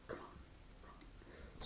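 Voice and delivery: whispered under the breath; a hushed, voiceless urging. Retold or imitated. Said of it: "It's like".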